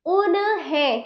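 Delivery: उन्हें slowly as u-na-hen; wrong full न. Shown as a demonstration. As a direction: add a vowel after न in उन्हें. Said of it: "पूरा ना होता क्या?".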